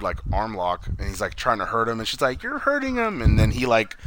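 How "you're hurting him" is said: high-pitched protesting cry; hammed-up high plea; mock distress. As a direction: imitate a woman pleading, pitched way up. Retold protest during the fight.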